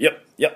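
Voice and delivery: short "よ", like refusing by reflex; quick "よ" of refusal. Shown as a demonstration. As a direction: jerk out "よ" as a reflex no. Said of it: "反射的に拒否するような感じ".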